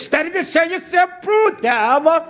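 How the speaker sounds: high, sing-song mock of the stock saying; mocking what everybody says. Mocking the people who always quote this scripture back. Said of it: "that's what everybody says".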